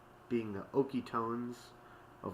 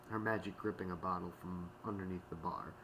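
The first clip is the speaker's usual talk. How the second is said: plain reading voice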